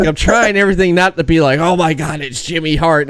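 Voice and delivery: 1 excited friend. Retold or imitated. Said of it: "he's like".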